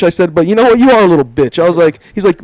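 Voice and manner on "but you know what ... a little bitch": raised, higher-pitched comeback; retold defiant insult. Trainee turning the insult on trainer, defiant. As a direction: act out throwing the insult back.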